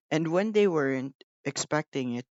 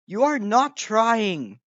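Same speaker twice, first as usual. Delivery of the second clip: slow stern scolding, higher and louder; severe; 7 semitones higher, 6 dB louder, slower than usual. A narrator's reading. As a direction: scold him slowly and sternly. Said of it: "talking to him severely".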